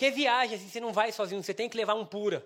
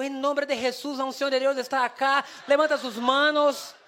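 mock preaching; 2 semitones higher, 4 dB louder than usual. Preacher re-enacting his first Spanish sermon, mock fervent preaching. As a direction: build to a loud stretched altar call.

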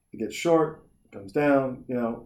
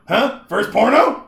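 higher, louder mock-casual question; teasing veteran; 8 semitones higher, 6 dB louder, slower than usual. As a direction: imitate someone ribbing a startled newcomer. Acted man teasing the newcomer.

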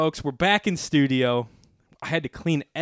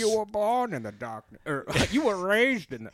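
Bane voice; 7 semitones higher than usual. Acted. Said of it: "You sound like somebody".

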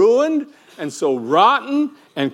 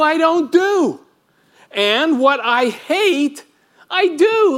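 higher, climbing in disbelief at himself; exasperated; 8 semitones higher, slower than usual. Retold or imitated. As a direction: throw up your hands at yourself. Paul's exasperated confession.

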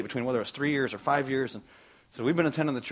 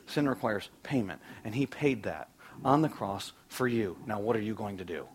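God's note; 3 semitones lower, 6 dB quieter than usual. Acted. Preacher voicing God's note to the listener, softly. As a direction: read God's words quietly and tenderly. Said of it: "a note from God".

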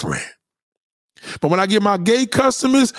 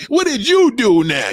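exasperated, accusing stress on YOU; 4 semitones higher than usual. Acted. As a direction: turn it back with a hard YOU. Better yet.

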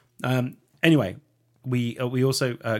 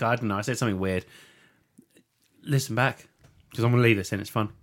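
normal talking voice

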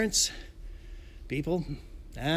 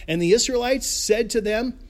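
normal talking voice

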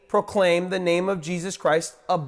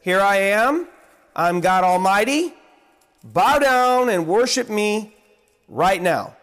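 higher, louder commanding voice; imagined God commanding; 4 semitones higher, 5 dB louder than usual. Pretend. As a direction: demand worship from on high. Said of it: "He could have said".

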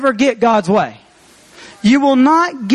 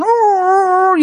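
long, high "ooh"; imitated monkey howl; 9 semitones higher than usual. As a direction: wail it high like the hurt monkey. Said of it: "me walking around going".

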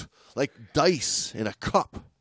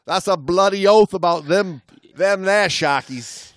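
hammed-up Aussie, a bit louder; louder mock Australian accent; 3 semitones higher, 4 dB louder than usual. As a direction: keep the fake Australian bit going. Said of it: "our accents aren't gonna carry us".

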